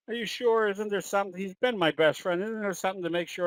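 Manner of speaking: joke-telling imitation of a desperate customer; mock pleading. Joke: owner pleads with vet.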